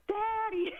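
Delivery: a kid shrieking for her father, retold; high-pitched retold cry of Daddy; mock childhood scream for daddy.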